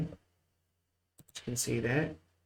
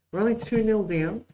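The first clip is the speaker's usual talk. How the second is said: exasperated, much higher and louder; high, loud exasperation; 9 semitones higher, 6 dB louder than usual. Real, not acted. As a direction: pitch jumps up, voice louder. Goal conceded, wobbly game.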